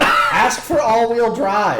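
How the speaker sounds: high, loud laughing prompt; slow, cracked-up retold coaching; feeding a line. Coaching the four-year-old between cackles, loud laughing prompt. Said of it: "I was like".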